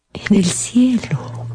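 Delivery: slow whispered answer; mother gently telling her son of the death; hushed whisper. Acted. Mother breaks the news gently.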